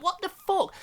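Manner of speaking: shocked disbelief. Retold or imitated. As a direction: react like you can't believe it. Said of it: "I was like".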